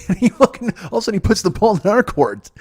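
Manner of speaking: cracking up at the nerve of it; laughing in disbelief. Real, not acted. To listeners, laughing disbelief at his friend.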